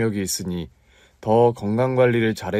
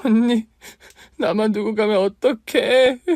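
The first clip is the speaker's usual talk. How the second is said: high, loud, slow sobbing plea; crying; 12 semitones higher, 5 dB louder than usual. Acted. Aunt cries to her dead sister, loud and sobbing. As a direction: wail it through tears. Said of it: "얼마나 울었는지 모릅니다".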